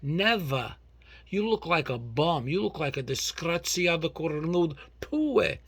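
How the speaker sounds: loud, worked-up scolding; indignant rant. Real, not acted. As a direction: give them a proper outraged tirade. Ranting about guests coming empty-handed.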